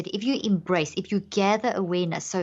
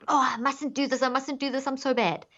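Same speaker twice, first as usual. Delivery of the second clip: higher, fretful self-blame; mock panicked self-blame; 6 semitones higher than usual. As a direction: berate yourself in a fluster. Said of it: "versus if I".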